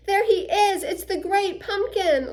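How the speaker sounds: high, loud thrilled cry; excited shout. Acted. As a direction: shout it like he finally sees him. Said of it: "Linus shouted".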